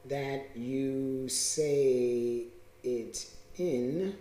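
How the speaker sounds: very slow, low and soft; drawn-out dictation. Real, not acted. Speaker noting the tone point, drawn-out. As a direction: spell it out word by word, unhurried. Dictating words while writing them out.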